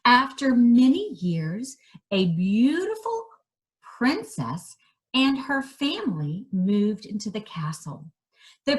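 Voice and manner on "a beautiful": ordinary delivery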